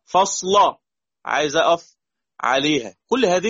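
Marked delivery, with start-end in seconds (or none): 0.0-0.8 "فصلا" higher, with a thick final "la"; tongue back for the dark l ending "فصلا"; heavy lam. Shown as a demonstration. Teacher repeats word before pausing on it, heavy lam. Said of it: "تفخم ولا ترقق؟".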